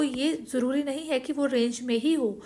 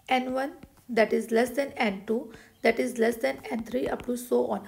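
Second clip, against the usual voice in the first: slow dictation; much slower than usual. Shown as a demonstration. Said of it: "dictating theorem slowly".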